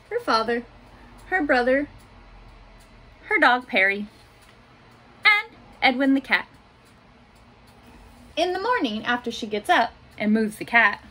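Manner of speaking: put-on gentle picture-book reading for little kids; reading to children. A narrator's reading. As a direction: read it like story time in class. Teacher reads picture book aloud.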